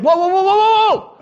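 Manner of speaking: high, loud string of whoas; alarmed calling out. Acted. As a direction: holler to stop someone bolting out the door. Heaven stopping Gabriel running out.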